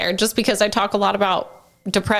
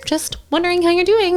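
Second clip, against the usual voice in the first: bright quoted greeting; 10 semitones higher than usual. Retold or imitated. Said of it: "I'm like".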